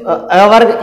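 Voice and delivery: usual speaking voice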